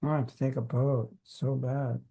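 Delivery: low, soft, whiny mock complaint; mock complaining. Imitating a devotee who moans about a boat trip, mock whine. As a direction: sulk about the boat in a put-on moan. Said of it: "You didn't hear Prabhupada complaining".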